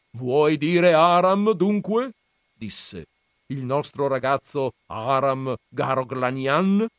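molto più acuto e lento, stupito; nonno incredulo. Acted. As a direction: trascina le parole incredulo. (nonno incredulo chiede allo zio, acuto e lento)

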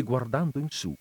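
ordinary delivery

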